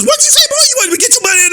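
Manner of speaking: high, sharp scolding mother; stern mama. Retold or imitated. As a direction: snap back like a strict mom. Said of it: "my mama, she didn't play".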